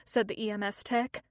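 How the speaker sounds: ordinary delivery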